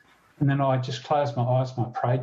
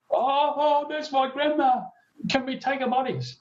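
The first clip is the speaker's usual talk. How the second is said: much higher, put-on voice for the young men; imitating eager young blokes; 13 semitones higher than usual. Two young blokes asking to take their grandma, in a high put-on voice. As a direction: pitch way up to mimic the blokes asking.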